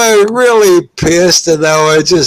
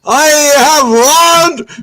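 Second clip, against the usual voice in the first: high, slow proclamation; son's grand announcement; 8 semitones higher, slower than usual. Retold or imitated. Son proclaims at the festival, grand announcement. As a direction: announce it grandly, stretched and pitched up.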